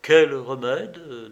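plain reading voice

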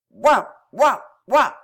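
three loud, high barks; dog barking. A narrator's reading. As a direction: bark "woof" like a dog. Barks punctuate the minister's speech.

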